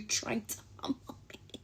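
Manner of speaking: breathy incredulous whisper. Real, not acted. To her viewers, still outraged at his line, whispering. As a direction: hiss the disbelief under your breath. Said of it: "whispered outraged aside".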